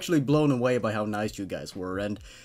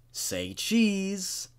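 creepy intruder; 7 semitones higher than usual. A narrator's reading. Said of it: "I heard him say".

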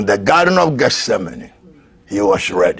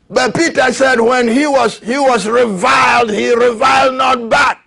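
preaching emphasis; 13 semitones higher, 5 dB louder than usual. Acted. Preaching about Peter.

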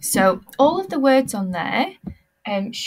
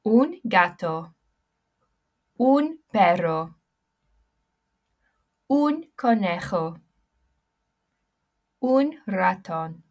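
Spanish accent. Shown as a demonstration. Teacher models pet words in a Spanish accent. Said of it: "Everybody repeat after me".

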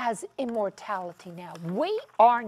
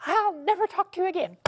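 huffy retort; 7 semitones higher, 4 dB louder, faster than usual. Retold or imitated. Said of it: "And she just says".

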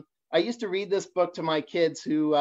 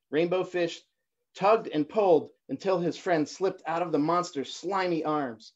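ordinary delivery, nothing marked

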